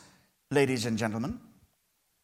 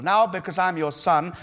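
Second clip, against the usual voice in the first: ordinary talk, nothing marked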